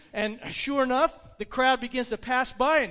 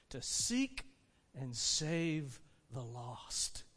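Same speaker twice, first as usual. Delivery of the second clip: soft, measured scripture with long pauses; slow down and let it fade to a hush; solemn pronouncement; 5 semitones lower, 6 dB quieter than usual. Acted. Jesus's solemn closing words, recited.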